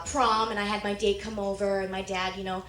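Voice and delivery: usual speaking voice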